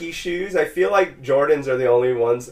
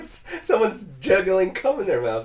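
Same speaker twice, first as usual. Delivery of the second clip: laughing; 5 semitones higher, 4 dB quieter than usual. Retold or imitated. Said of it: "I was like".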